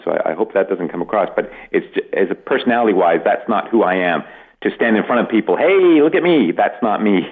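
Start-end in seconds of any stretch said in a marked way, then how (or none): 5.6-6.5 loud, high showing-off cry; brash look-at-me call to the crowd; show-off comedian. Retold or imitated. Mocking attention-seeking comedians, a brash call.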